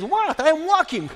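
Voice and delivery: high, innocent protest; puzzled reply, retold. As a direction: answer as if nothing is wrong. Father's oblivious answer to friend.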